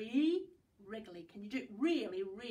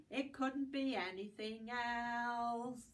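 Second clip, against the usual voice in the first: slow; slower than usual. Shown as a demonstration. Rhyme closing line.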